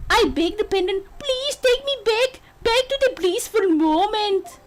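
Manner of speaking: high, dramatic attempted Scottish accent. Bride tells of her wish working, in a high attempted Scottish accent. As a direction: plead and exclaim as an amateur Scot. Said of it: "could do a Scottish accent".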